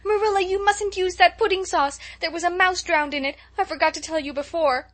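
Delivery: high, loud panicked shriek. Acted. Girl shrieking the warning to Marilla at the dinner table, panicked shriek. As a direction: blurt the warning out in a frantic scream. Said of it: "shrieked out".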